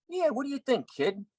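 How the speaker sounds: high, tough-guy Pesci imitation; a light impression of a wise-guy mentor; Joe Pesci voice. Joe Pesci teasing the young actor, tough-guy voice. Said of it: "He goes".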